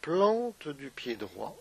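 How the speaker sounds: unhurried meditation guide with long pauses; guided meditation. Acted. Yoga teacher guiding listeners through a body scan, guided meditation.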